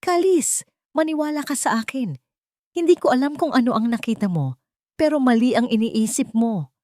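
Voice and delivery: high, urgent begging; pleading. A narrator's reading. Wife pleads with her husband, high and begging. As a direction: beg him to believe you. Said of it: "Pagmamakaawa ni Yasmin".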